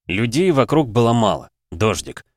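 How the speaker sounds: plain reading voice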